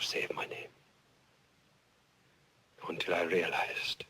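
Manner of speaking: low, soft, halting with long pauses; hushed confession. Acted. Witness admitting to Your Honor his silence, hushed confession. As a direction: confess quietly, dropping almost to a whisper.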